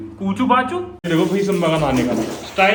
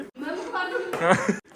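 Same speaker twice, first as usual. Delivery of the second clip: laughing; 9 semitones higher, 7 dB quieter than usual. Real, not acted. Laugh at the hit.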